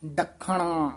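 ਡੱਖਣਾ with firm kh and retroflex n; tight pronunciation. Shown as a demonstration. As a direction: grip the kh in ਡੱਖਣਾ, never loose. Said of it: "ਪੋਲਾ ਨਹੀਂ ਬੋਲਣਾ".

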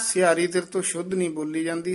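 usual speaking voice

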